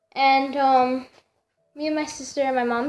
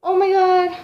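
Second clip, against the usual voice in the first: alarmed; 5 semitones higher, 4 dB louder than usual. Retold or imitated. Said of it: "she was like".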